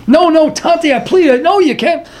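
high, urgent daughter's plea; pleading. Retold or imitated. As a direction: beg him not to, pitched well up. Daughter begs her father not to tell.

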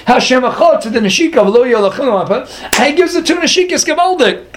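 high, impassioned sermon voice carried at full tilt; fervent preaching. Acted. Rabbi stirring his listeners about the kiss, fervent preaching. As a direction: preach it with rising fire.

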